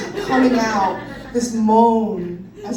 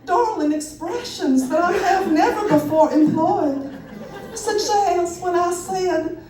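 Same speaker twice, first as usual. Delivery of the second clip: higher-pitched, hammed-up Southern belle drawl; 6 semitones higher than usual. Storyteller imitating the movie star's talk for the audience, Southern drawl. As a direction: play up a darling Southern accent. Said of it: "speaking in a Southern drawl".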